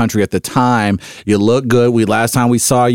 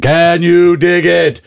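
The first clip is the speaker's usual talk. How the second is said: high, slow, belted catchphrase; Shaq; 9 semitones higher, 4 dB louder, much slower than usual. Acted. Basketball star dodging the question, high drawn-out Shaq catchphrase. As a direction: sing out the catchphrase, long and high. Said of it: "I'm gonna go Shaq".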